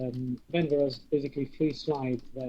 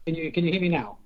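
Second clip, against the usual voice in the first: raised voice over a bad line; speaking louder; 4 semitones higher, 5 dB louder, faster than usual. Real, not acted. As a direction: project so the far end hears. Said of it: "Just speak louder".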